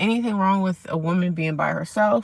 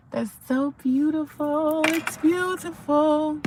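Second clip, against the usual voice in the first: high, drawn-out admiring coo; awed delight; 7 semitones higher, slower than usual. Real, not acted. Streamer admiring the sunrise for viewers, awed and delighted. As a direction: stretch it out high in wonder.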